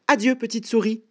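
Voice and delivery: high-pitched alarmed goodbye; hurried frightened farewell. Acted. Snake fleeing from the gruffalo, high alarmed farewell. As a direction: bid farewell in a high panic.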